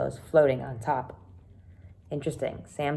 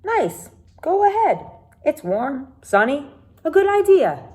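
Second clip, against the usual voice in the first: much higher, louder, bright and friendly; cheery Mac; 9 semitones higher, 6 dB louder than usual. Acted. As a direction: voice Mac as upbeat and encouraging. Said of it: "Mac nodded".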